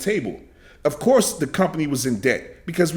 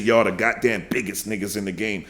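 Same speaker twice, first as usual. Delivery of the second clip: mocking quote; 8 semitones lower, 4 dB quieter than usual. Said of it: "I never said".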